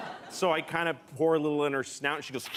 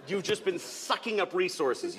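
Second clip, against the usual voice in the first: ordinary delivery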